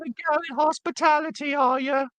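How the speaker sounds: very high, put-on British lady; British woman voice. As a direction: squeak it out like a posh dame.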